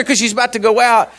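usual speaking voice

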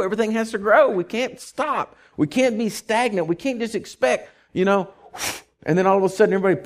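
high, loud preaching build; fiery preaching. Acted. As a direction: preach it hard to the congregation. Preaching at full force.

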